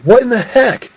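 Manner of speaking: high-pitched bafflement; acted shock; shocked exclamation. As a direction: exclaim it high, taken aback. Shocked at a blast gone wrong.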